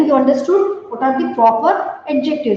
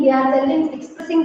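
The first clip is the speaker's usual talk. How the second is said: usual speaking voice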